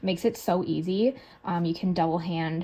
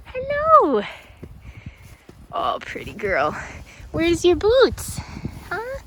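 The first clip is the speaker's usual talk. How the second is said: high cooing pet voice; talking to a horse; 10 semitones higher, 6 dB louder than usual. Real, not acted. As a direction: coo slowly to the horse.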